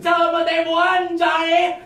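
high booming announcer shout; game show announcer. Acted. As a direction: call it like a TV host.

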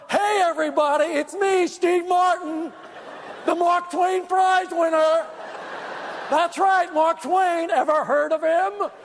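hammed-up show-off shouting his own name; bragging yell. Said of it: "started yelling".

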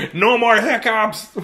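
high, slow exclamation; hammed-up relief; mock celebration. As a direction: crow it comically between laughs. Mock celebration after gigs canceled.

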